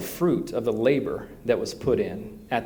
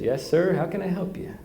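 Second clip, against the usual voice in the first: a light imitating Texan accent, higher; friendly Texan farmer accent, lightly voiced; Texan drawl; 8 semitones higher than usual. Texan farmer greeting the minister, mock Texan accent. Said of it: "That's how they talk in Texas".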